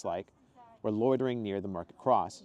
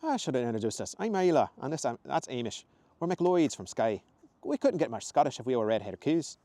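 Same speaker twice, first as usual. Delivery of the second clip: higher-pitched Scottish woman; friendly Scottish introductions, lilting and raised; Scottish accent; 4 semitones higher, 5 dB louder than usual. Acted. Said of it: "much softer Scottish accent".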